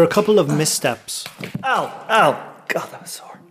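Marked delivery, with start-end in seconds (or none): 1.6-2.5 pained ow. Real, not acted. Slipping in cave, hurt. 2.7-3.4 breathy whisper after a knock; pained whisper. Real, not acted. Hurt, alone in dark cave.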